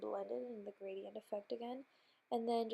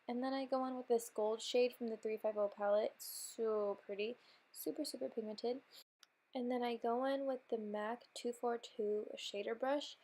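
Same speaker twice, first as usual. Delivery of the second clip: ordinary talk, nothing marked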